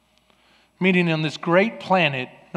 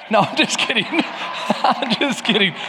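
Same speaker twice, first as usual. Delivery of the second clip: laughing; 6 semitones higher, 5 dB louder than usual. Real, not acted. Laughs off his joke.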